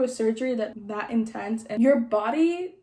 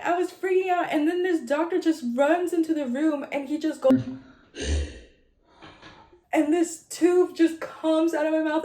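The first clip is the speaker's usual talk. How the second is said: ordinary delivery, nothing marked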